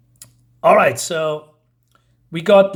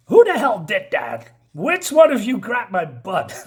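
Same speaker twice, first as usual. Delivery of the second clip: higher-pitched mock outrage, breaking into a laugh; indignant shouting; 4 semitones higher, 3 dB louder than usual. Sergeant confronts diner crowd after being grabbed, comic indignant shout. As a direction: demand the culprit in a hammed-up shout. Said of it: "And then she's shouting".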